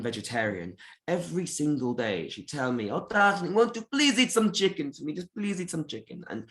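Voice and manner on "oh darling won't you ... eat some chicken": lightly imitated Egyptian accent, higher; a hint of a doting Egyptian grandma; Egyptian grandmother accent. Grandmother coaxing vegetarian grandchild, mild Egyptian accent. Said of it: "had a very thick Egyptian accent".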